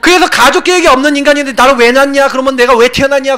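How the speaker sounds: high, indignant retold protest; retold anger. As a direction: replay your younger self's aggrieved complaint. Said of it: "너무 화가 나갖고".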